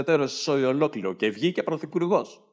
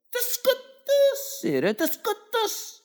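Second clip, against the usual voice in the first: very high, slow squealing put-on voice; shrill hammed-up wail; mock squeal; much slower than usual. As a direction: squeal it out like a scandalised auntie.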